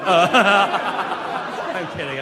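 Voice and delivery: ordinary talk, nothing marked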